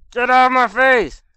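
high, loud furious yell; angry shout. Real, not acted. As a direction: bark it at the enemy. Fighting enemy up close.

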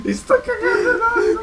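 high, laughing in fright; nervous laughter. Real, not acted. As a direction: laugh through the panic. Terrified player laughing.

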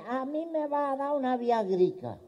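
high, slow put-on old man's voice; creaky old grandpa asking the pharmacist, drawn out. Old man at the pharmacy asks for Viagra, high and slow.

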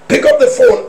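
inner voice urging. Retold or imitated. Preacher voicing the urge to answer the phone, high. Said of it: "repeated line".

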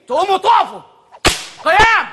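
loud, high barked order to stand; shouted command. Acted. Teacher shouting at his pupils to stand, loud barked order. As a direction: bark the command at the class.

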